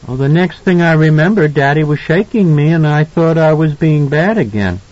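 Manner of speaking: put-on high child's voice, recounting; earnest little boy. A narrator's reading. Son explaining to his parents, little boy voice.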